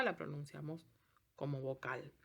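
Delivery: usual speaking voice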